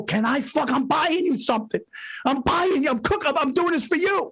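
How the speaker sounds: exasperated. Retold or imitated. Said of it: "I just wanted to go".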